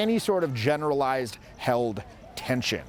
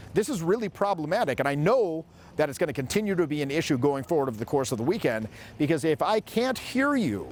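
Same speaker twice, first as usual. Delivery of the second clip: usual speaking voice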